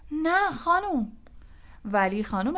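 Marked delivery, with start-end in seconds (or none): 0.1-1.1 high, drawn-out cry of protest; shouted protest. A narrator's reading. Fred objects to the referee's penalty, shouted protest. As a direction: call out the objection, stretched and high. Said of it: "فرد فریاد زد".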